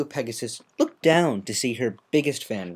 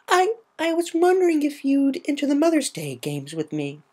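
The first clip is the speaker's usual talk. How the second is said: put-on much higher young voice, stumbling at the start then pushing through; shy filly asking. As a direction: blurt out the brave request. Scootaloo finally asks her hero.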